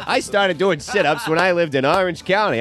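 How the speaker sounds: high, eager local TV pitch; amateur local ad. Pretend. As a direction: hype it like a cheesy local commercial. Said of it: "this sounds like a local commercial for sit-ups".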